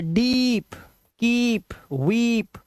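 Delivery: डीप, कीप, वीप with a long high ee; long vowel examples. Shown as a demonstration. Teacher giving examples of long ई, drawn-out vowels in डीप, कीप, वीप. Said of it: "ई की आवाज़, जैसे".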